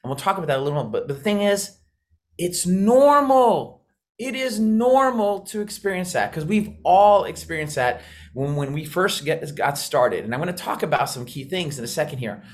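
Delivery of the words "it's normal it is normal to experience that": NORMAL stretched out high and loud; emphatic reassurance. Real, not acted. Coach reassuring new coaches that fear is normal, emphatic stress on NORMAL. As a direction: lean hard and long on NORMAL. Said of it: "I wanna emphasize that word".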